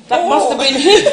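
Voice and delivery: can't keep a straight face; laughing out the punchline. Acted. Mum realises she ate him.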